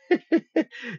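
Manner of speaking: loud high burst of laughter; real laugh, high and loud. Real, not acted. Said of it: "laughing at own story".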